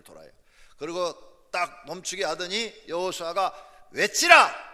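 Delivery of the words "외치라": explosive, high battle order; Joshua's shouted command. Acted. Joshua orders the people to cry out, commanding shout. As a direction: bellow the order to the whole army. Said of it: "이렇게 소리를 지르면".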